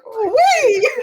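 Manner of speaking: high, loud, drawn-out squeal of glee; Loran. Acted. Game master plays a character helicoptering about, high gleeful whoop. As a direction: whoop it high and long, half laughing. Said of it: "and he's like".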